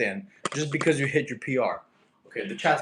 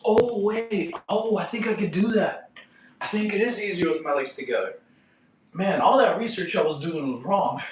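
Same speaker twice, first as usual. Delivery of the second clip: higher-pitched excited realization; real excitement, pitched up; a little excited; 6 semitones higher than usual. Real, not acted. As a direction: get excited as it dawns on you. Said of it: "I just got a little excited".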